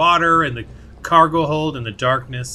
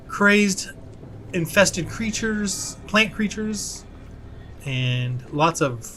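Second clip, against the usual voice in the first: ordinary delivery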